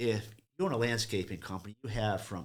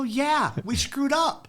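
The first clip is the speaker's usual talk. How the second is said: squeaky high-pitched confession; sheepish admission; 4 dB louder, much slower than usual. Retold or imitated. Mechanic admitting fault to the surprised customer, squeaky high confession. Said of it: "I go".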